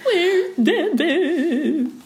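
high, long, wavering mock wail; mock wailing cry. Teasing friend who cried over absent boyfriend, mock wailing. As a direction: draw it out as an exaggerated bawl. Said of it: "I was crying because Devin wasn't there".

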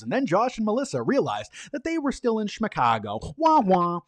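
high, sing-song mock narration; mocking narrator. Narrator mocking the trapped couple to camera in sing-song. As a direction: tease them with a sad-trombone wah wah. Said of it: "he goes".

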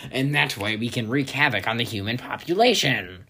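loud put-on mosquito voice; booming, scheming insect voice. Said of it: "that's my mosquito voice".

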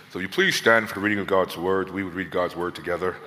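a light take on a formal pulpit reading voice; imitating Pastor Sean. Guest preacher copying Pastor Sean's pulpit call, lightly voiced. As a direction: mimic a pastor calling the congregation to stand. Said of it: "I saw Pastor Sean do this".

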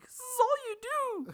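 exaggerated high-pitched mimicry; imitated girlfriend. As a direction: squeak it out as the mocking girlfriend. Acted retold girlfriend, second time.

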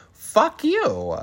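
high, loud snapped retort; retold angry retort. Maddie telling Terry off, high and loud. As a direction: fire it back hard. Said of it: "And Maddie goes".

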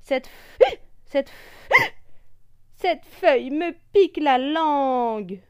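high grumble broken by hiccups; grumble, tripping on hiccups; grumbling with hiccups. Acted. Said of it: "bougonne le perroquet". (ronchon avec le hoquet)

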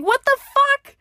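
high, loud shocked what the fuck; shocked outburst. Retold or imitated. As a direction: blurt it out in pure disbelief. Hearing the meth rumor.

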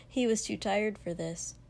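ordinary delivery, nothing marked

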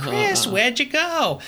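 high-pitched, drawn-out cartoon call; Family Guy impersonation. Cartoon character calling after vanished Chris, high impersonation. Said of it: "That was a decent impersonation".

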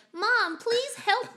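put-on high, slow little boy pleading; a small kid begging his mom, slowly. Voice actor redoing a child's line, high and slow. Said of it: "three, four takes to be like".

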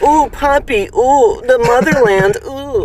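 high-pitched, drawn-out Russian accent; hammed-up Russian accent, cooing up high; silly Russian accent. Shown as a demonstration.

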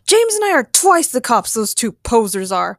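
loud, scornful bragging; indignant, projected boast; shouted boast. Acted. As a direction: shout down the rivals. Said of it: "he shouted".

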